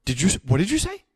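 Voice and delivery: high, loud disbelieving yelp; stunned that his friend read his mind; shocked. Real, not acted. Surprised yelp as his friend names Kathy Bates.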